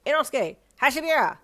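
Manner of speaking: loud, high name proclamation; brash shouted introduction; announcing. Acted. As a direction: bellow your name proudly. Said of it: "he announced again".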